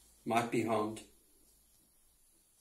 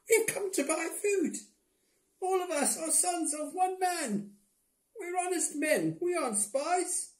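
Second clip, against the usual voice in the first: very high, squeaky brothers voice; brothers' voice; 4 dB quieter than usual. A narrator's reading. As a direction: pitch way up for the brothers.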